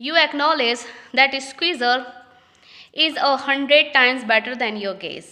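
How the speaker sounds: reading each English line slowly and carefully; slow play reading. Acted. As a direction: read it out like a play script, word by word. (धीमी, साफ़ नाटक पढ़ाई)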